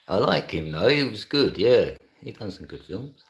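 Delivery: ordinary talk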